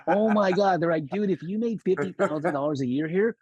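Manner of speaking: high, amused boss voices; mock laughing bosses. Bosses laughing at the rookie's salary. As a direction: tease him through laughter.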